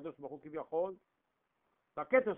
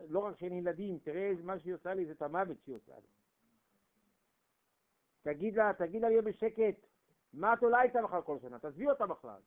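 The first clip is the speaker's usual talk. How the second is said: ordinary talk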